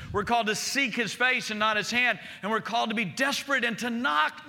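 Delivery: high, pressing sermon voice; full-force preaching. Real, not acted. Pastor urging his church to persistence, fervent preaching. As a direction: urge the church on.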